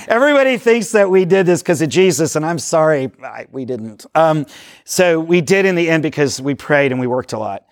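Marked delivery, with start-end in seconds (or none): none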